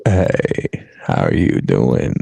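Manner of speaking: very low, slow ASMR greeting; teasing, intimate mock ASMR; playful ASMR. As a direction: breathe it slowly right into the microphone. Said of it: "not the A S M R".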